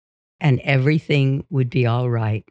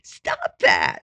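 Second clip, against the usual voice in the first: imitated shriek; 11 semitones lower than usual. Imitated wife frightened by growling husband, restrained mock shriek. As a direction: shriek it without real volume. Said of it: "she'd shriek".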